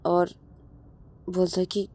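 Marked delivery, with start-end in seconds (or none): none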